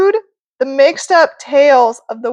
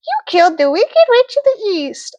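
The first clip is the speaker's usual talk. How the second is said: high, sweet fairy voice; sing-song good witch; Glenda the good witch; 9 semitones higher than usual. Acted. Glenda answers.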